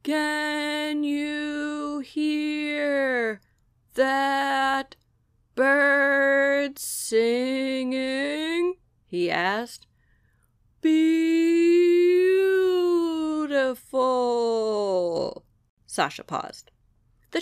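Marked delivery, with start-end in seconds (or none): 0.0-8.8 very slow, stretched sloth voice; sleepy sloth. Acted. Sloth introduces himself to Sasha, extremely slow. 10.8-15.4 a very slow, loud sigh of beautiful; awed sloth. Acted. Sloth marvels at the birdsong to Sasha, slow and awed.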